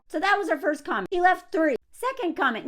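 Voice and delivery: ordinary talk, nothing marked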